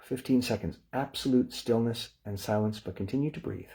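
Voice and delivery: calm, measured breathing instructions; guided breathing cue. Acted. Meditation teacher guiding the group, calm and measured. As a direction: talk them into the breath gently.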